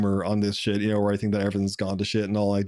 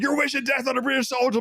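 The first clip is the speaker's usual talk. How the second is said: much higher mock offended voice; mocking the soldier. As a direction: parody the soldier's outrage, pitched way up.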